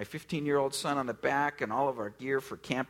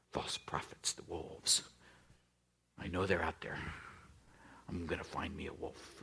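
hushed, much lower and softer; mock conspiratorial whisper; 10 semitones lower, 10 dB quieter than usual. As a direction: whisper like a suspicious wolf-hunter. Imagined churchgoer hunting wolves.

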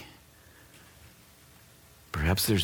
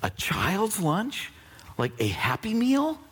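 preaching at full force. Acted. Pastor preaching to the congregation, full force. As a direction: preach it out to the crowd.

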